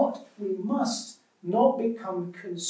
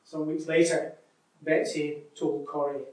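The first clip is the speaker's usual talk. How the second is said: lively read-aloud with voiced sisters; story reading; 2 semitones higher, 5 dB louder than usual. Preacher reading a story aloud to church, lively.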